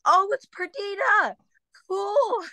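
very high drawn-out squeal; exaggerated high-pitched fake recognition; mock enthusiasm. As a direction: play along in a high, slow falsetto. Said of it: "And I'm like".

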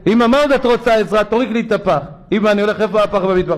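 over-eager to help, again; eager sweet son. Retold or imitated.

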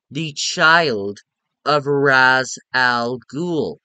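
put-on "child" hit hard, then "of Ra's al Ghul" very slowly; emphatic slow repeat. Correcting critics who missed the plot, slow insistent stress on "child".

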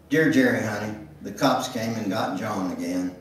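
ordinary delivery

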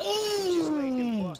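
impressed ooh. Real, not acted. Reacting to a teammate's clip.

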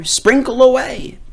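frantic, high command. Acted. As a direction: hurry the witch along. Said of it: "frantic father".

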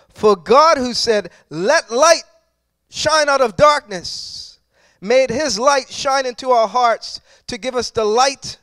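loud, driving sermon voice, hitting each phrase hard; preaching at full force. Acted. Pastor exhorting the congregation on God's power, loud full-force preaching. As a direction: preach it out with rising fire.

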